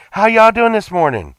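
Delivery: bright high friendly hello; cheery greeting. Retold or imitated. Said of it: "I'm like".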